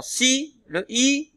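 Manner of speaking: "si" set apart and held; syllable singled out. Shown as a demonstration. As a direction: stress "si" high and long, then "le I". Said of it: "exactement dans quelle syllabe".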